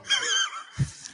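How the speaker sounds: high, shrieking burst of laughter. Real, not acted. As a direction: let out a squealing laugh.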